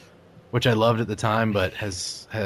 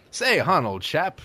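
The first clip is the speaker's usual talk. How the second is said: mock Elizabethan accent; 6 semitones higher than usual. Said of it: "Elizabethan accent".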